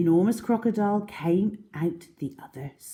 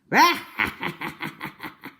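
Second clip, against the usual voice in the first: rattling, teeth-chattering laugh; big belly laugh; 3 semitones higher, 4 dB louder than usual. A narrator's reading. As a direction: laugh so hard the teeth shake. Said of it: "laughed so much that his teeth rattled".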